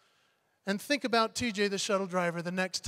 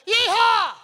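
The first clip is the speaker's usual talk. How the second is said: high, loud whooping Yeehaw; group cheer shout; 12 semitones higher, 9 dB louder than usual. Retold or imitated. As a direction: whoop it out with the crowd. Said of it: "And we said".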